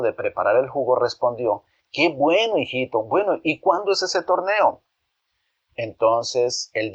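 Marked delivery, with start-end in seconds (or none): 1.9-4.8 higher, kindly mother voice; a mom glad for her little one; pleased mother. Acted. Mother answers her son's news, lightly voiced and pleased.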